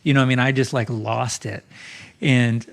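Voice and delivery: usual speaking voice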